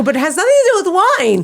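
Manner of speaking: high, loud, laughing through it; amused disbelief at the mix-up; laughing protest. Retold or imitated. She answers the stranger at the stairs, laughing loudly.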